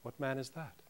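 ordinary delivery

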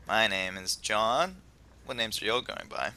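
ordinary talk, nothing marked

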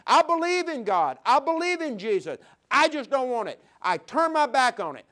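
defiant refusal. Acted. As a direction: sound like a careless partier shrugging God off. Defiant sinner rejecting God.

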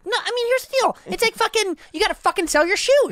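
squeaky guru telling you to hustle; Gary Vee impression. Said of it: "he's like".

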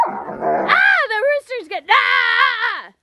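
high, sudden real shout of alarm; startled shriek. Real, not acted. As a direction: yelp as the rooster charges. Rooster attacks the child.

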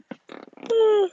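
long high breathy sigh; tired sigh. Real, not acted. Tired, needs coffee.

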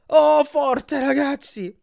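very high, excited cry; thrilled. Acted. Grover enjoying the massage bed with his friends, thrilled. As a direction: whoop it high with a long opening "oh".

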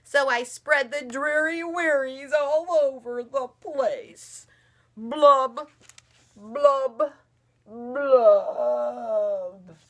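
slow, loud, droopy fish voice with mopey blubs; sing-song glum fish, dragging every word; gloomy pout fish. Acted. Mother reading to daughter as the glum fish.

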